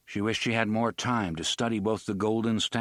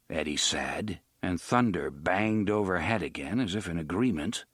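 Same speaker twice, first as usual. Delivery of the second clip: plain reading voice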